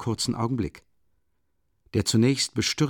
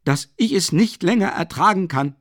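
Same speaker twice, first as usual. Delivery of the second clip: high, loud furious outburst; raging, raised voice; blind rage; 8 semitones higher, 6 dB louder than usual. Acted. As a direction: spit it out in blind fury. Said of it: "in blinder Wut".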